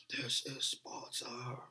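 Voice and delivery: slow breathy whisper of the famous line; whispered movie quote. Retold or imitated. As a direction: whisper it slowly, like a secret battle cry. Quoting 300 line.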